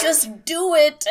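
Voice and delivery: slow, high and loud, laughing through it; cracked laughing shout at the screen; laughing yell. Retold or imitated. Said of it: "everyone's just like".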